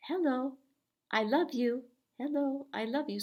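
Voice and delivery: high, sweet little puppet voice; tiny puppet voice. Pretend. Teacher voicing a tiny paper puppet for kids, high and sweet. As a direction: let the tiny puppet squeak out its love. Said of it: "And you say".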